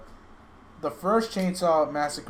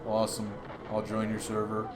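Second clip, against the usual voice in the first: streamer voices; 3 semitones lower, 4 dB quieter than usual. Retold or imitated. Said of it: "They both sound the same".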